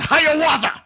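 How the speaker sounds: high, loud whooping yell; battle cry. Pretend. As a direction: yell it as you leap. Battle cry jumping on gator.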